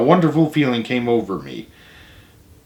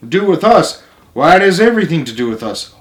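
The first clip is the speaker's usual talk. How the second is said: near-shout, higher and louder, rushing; almost shouting; 5 semitones higher, 5 dB louder than usual. A narrator's reading. Grandpa thrilled about the coon hunt, almost shouting. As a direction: burst out with excitement. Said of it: "and almost shouted".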